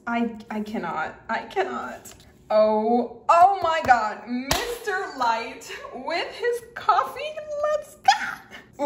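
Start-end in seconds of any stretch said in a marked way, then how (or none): 2.5-8.9 excited. Real, not acted. Excited at sticker.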